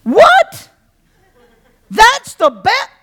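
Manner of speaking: high, loud exclamation of disbelief; incredulous. Real, not acted. Preacher mocks the disciples' question with disbelief. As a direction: throw it out in total disbelief.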